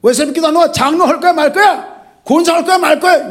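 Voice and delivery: loud, high fatherly scolding; mock scolding. Shown as a demonstration. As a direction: scold the elder like a stern father. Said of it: "뭐 이렇게 혼낼 수 있어야". (호통치듯 높고 크게 꾸짖는 목사)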